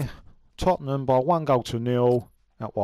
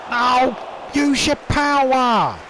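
higher, louder, slow pleading yell; frustrated yell; 9 semitones higher, 4 dB louder, slower than usual. Real, not acted. Gamer yelling at his player, slow pleading yell.

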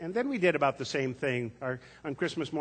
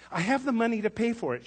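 put-on much higher, louder child voice; a child standing her ground; determined little girl; 11 semitones higher, 5 dB louder than usual. A narrator's reading. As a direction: Tess insisting to the pharmacist. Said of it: "she said".

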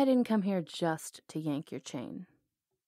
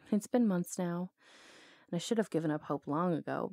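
ordinary talk

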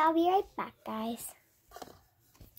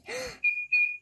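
high gasp. Pretend. Gasps at the bird.

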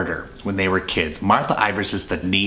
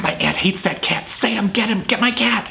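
much higher, urgent calling; panicked plea; 13 semitones higher than usual. Retold or imitated. Martha begging Sam to catch the cat, panicked. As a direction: plead for help in a panic.

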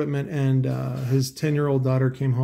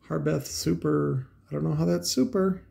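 normal talking voice

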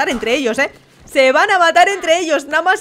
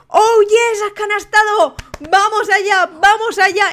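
higher, punchy commentator cheer; excited cheering; 5 semitones higher than usual. Real, not acted. Host celebrates a basket scored, excited cheering. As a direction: urge the players on excitedly.

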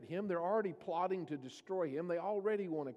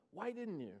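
hurt question; 2 semitones higher, 6 dB quieter than usual. Retold or imitated. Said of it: "Sounds like difficult times".

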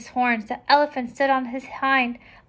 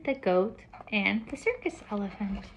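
ordinary delivery, nothing marked

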